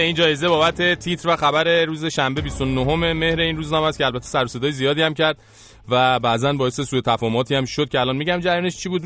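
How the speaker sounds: ordinary talk, nothing marked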